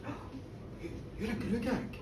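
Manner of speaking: soft, halting realisation; hushed awe. Acted. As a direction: ask it under your breath, faltering. Old man realises who stands there.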